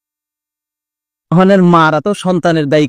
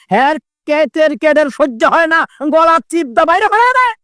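high-pitched exasperated outburst; angry father; 8 semitones higher than usual. Acted. Father fed up with the child's crying, high angry complaint. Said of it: "বাবাই দেখে রাধে কইতাছে".

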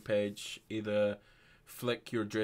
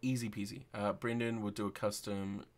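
ordinary talk, nothing marked

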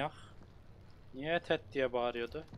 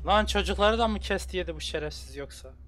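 horrified loud outburst; 5 semitones higher, 6 dB louder than usual. Real, not acted. Horrified at the killer.

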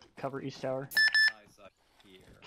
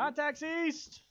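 shouted callout; 13 semitones higher, much slower than usual. Real, not acted. Callout of enemy contact.

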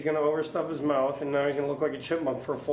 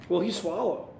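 usual speaking voice

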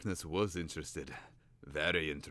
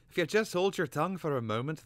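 higher, sharp impatience; impatient; 10 semitones higher, 4 dB louder than usual. A narrator's reading. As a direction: cut him off with irritation. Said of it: "the cater bird said impatiently".

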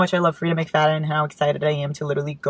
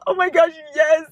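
excited cheer; 11 semitones higher than usual. Real, not acted. Excited about thriller books.

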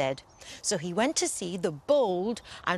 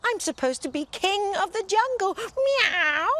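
very high, loud scaredy-cat wail; meowing cat; 12 semitones higher, 3 dB louder than usual. Acted. Lion turned scaredy cat wails, very high. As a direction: whine like a frightened kitten. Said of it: "he meowed".

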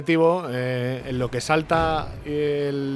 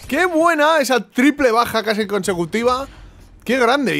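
high, loud, thrilled exclamation; pitched-up, loud celebration; elated praise; 8 semitones higher, 5 dB louder than usual. Real, not acted. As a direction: crow over your own great play. Still celebrating the kills.

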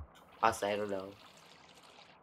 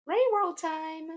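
squeaky hype voice; much slower than usual. Pretend. Streamer hyping game in squeaky voice.

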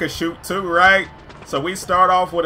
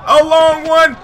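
loud, high yell; excited shot call; 8 semitones higher, 7 dB louder, slower than usual. Real, not acted. Player calls a long shot on the court, excited yell. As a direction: holler it as the shot flies.

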